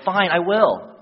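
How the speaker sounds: put-on loud, high-pitched childish defiance; mock a pouting boy snapping back loudly; upset, pouty. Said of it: "in his upsetness, in his poutingness".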